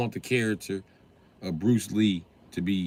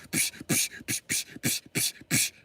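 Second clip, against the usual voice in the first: breathy unvoiced punch sounds; imitated kung fu punch effects; kung fu hit noises; 6 dB quieter than usual. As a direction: make movie fight whooshes with your mouth. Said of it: "cool fights and psh".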